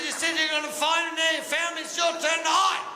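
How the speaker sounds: high, insistent arguing; comic indignant protest. Acted. Driver argues hide-and-seek with cop.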